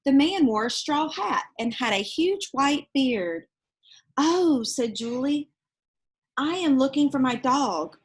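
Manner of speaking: put-on lively read-aloud voice for children; storybook reading. Reading a picture book aloud to children, lively storytelling. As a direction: tell it like a bedtime picture book.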